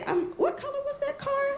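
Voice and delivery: very high, small guilty voice; sheepish question. Retold or imitated. Car owner sounding out the annoyed musician, sheepish high voice. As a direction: ask it high, hoping it isn't you.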